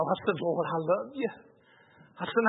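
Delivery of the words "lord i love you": quiet prayer. Retold or imitated. Humble prayer after repenting.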